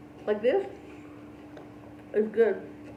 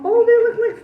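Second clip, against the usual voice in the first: much higher, louder sudden recognition; excited; 12 semitones higher, 15 dB louder than usual. Real, not acted. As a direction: burst out as it clicks.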